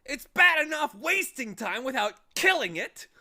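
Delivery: high, loud indignant scolding; outraged watchdog. Acted. Watchdog lecturing Milo, loud and high. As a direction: scold him with disgust.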